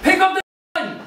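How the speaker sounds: high, loud gamer rage yell; furious high-pitched shout; screaming. Acted. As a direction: scream it at the game. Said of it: "Why are you screaming so loud".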